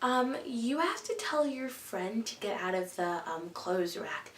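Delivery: hesitant, hushed request like a shop assistant; sales lady. Retold or imitated. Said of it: "the sales lady".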